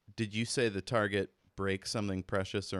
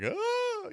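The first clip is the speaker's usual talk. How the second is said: startled yelp; 3 dB louder than usual. Acted. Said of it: "he's like".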